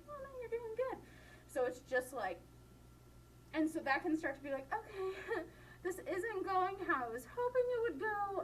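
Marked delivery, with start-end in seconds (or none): 0.1-1.0 high, softer encouraging voice; reassuring listener. Retold or imitated. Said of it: "a smile of". 4.7-8.4 high-pitched awkward mock unease; uncomfortable speaker. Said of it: "this is really uncomfortable".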